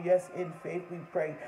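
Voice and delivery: ordinary talk, nothing marked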